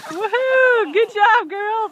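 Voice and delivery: high, loud whoop of praise; excited cheer. Real, not acted. Cheering girls after their run.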